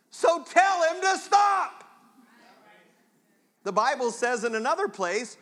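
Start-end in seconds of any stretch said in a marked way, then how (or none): 0.1-1.8 high, loud, slow command; rally the congregation, full force; loud, slow preached command; preaching shout. Real, not acted. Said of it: "preacher urging congregation".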